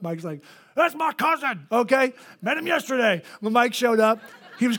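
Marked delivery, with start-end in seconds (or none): 0.8-3.2 mock protective outburst. Mike claims him as cousin.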